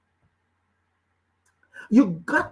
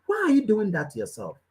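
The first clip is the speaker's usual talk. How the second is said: ordinary talk, nothing marked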